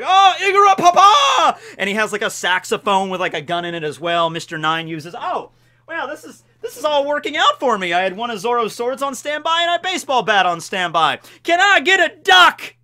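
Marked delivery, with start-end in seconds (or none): 0.0-1.6 high, loud, drawn-out holler; hammed-up battle cry. Acts out Igaram's firing cry. 5.1-11.2 pleased surprise. Real, not acted. Surprised at props within reach. 11.4-12.8 high, loud, drawn-out call; mock demanding shout. Calls out for a duck prop.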